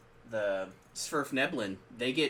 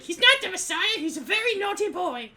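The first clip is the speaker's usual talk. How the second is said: very high, loud falsetto mum; shrill Python mum; 9 dB louder than usual. Retold or imitated. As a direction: screech it like a Monty Python mum. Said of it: "his mom's like".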